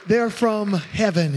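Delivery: ordinary talk, nothing marked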